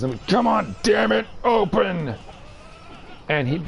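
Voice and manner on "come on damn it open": higher, strained plea at the Matrix; mock desperate shout; frustrated Ultra Magnus. Ultra Magnus straining to open the Matrix, mock frustrated. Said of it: "they say one of the only cuss words".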